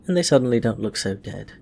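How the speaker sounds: plain reading voice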